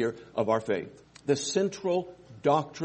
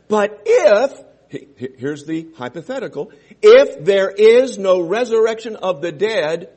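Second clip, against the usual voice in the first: "if" thrown out loud and high; loud emphasis; 4 semitones higher, 10 dB louder than usual. Acted. Preacher hammering the hypothetical at his church, loud. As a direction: shout the hypothetical to the hall.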